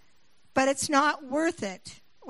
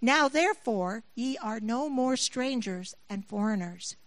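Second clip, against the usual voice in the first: normal talking voice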